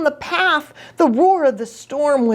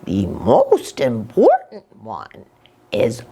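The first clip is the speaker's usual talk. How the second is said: slower, lower storytelling voice for the lesson; story moral; 7 semitones lower than usual. A narrator's reading. Storyteller delivers closing moral.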